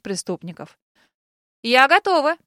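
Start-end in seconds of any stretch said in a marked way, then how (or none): 1.6-2.4 loud, firm declaration; loud announcement. A narrator's reading. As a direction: announce it loudly. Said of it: "громко объявила я".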